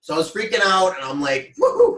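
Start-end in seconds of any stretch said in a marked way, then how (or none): 1.6-2.0 high, loud "woohoo"; sudden high-pitched whoop; freaked-out whoop. Retold or imitated. Acting out own freak-out.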